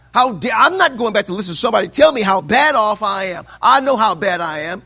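imitating his younger self, shouting in outrage; indignant shout. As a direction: shout it like the offended new convert. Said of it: "I was so mad at that preacher".

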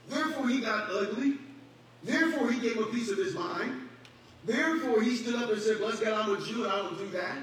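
full-force pulpit preaching. Acted. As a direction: preach it out with fire.

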